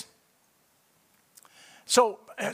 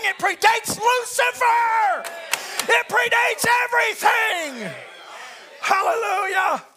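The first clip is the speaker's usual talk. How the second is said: high, loud pulpit shout, building to a peak; full-force preaching; 10 semitones higher, 6 dB louder than usual. Real, not acted. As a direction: preach it triumphantly at the top of your voice.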